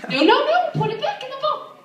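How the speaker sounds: loud, very high scolding; getting mad. Retold or imitated. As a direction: snap a frantic high-pitched order at a child. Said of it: "I'm, like, getting mad".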